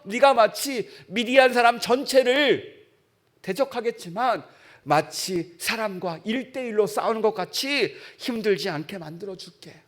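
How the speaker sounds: usual speaking voice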